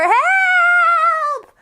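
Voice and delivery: high, long cry for help; drawn-out emergency shout, very high; calling for help. Acted. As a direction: yell for rescue, holding the vowel. Acted emergency call for help.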